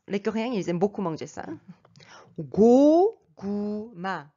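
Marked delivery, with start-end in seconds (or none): 2.4-4.3 고구마 drawn out slowly, syllable by syllable; Korean word demo. Shown as a demonstration. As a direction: stretch 고구마, closed lips on the first two syllables, open on the last. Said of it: "il faut bien fermer la bouche".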